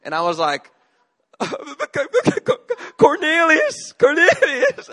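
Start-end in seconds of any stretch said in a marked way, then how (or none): 3.0-4.9 high, strained crying voice over laughter; sobbing name. Retold or imitated. Hurt boy telling teacher who hit him, high sobbing. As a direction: whimper the name like a hurt child.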